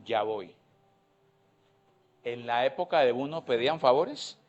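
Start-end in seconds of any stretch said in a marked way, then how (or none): 0.0-0.5 soft, low, reluctant "Ya voy"; unwilling mumble; lazy child's reply. Pretend. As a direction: drag it out like a lazy kid.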